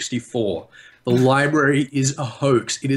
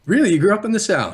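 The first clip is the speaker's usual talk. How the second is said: surprised; 10 semitones higher, 4 dB louder, faster than usual. Real, not acted. Surprised at guest's answer.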